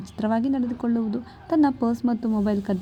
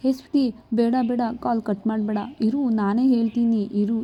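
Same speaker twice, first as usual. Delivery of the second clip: ordinary delivery, nothing marked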